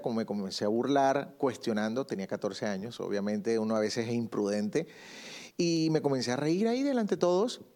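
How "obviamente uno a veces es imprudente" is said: usual speaking voice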